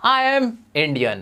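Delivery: stretched very slowly, each word apart; slow model phrase. Shown as a demonstration. Teacher modelling a new phrase for students, very slow. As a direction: draw the phrase out for the class. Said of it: "आप simply बोलो".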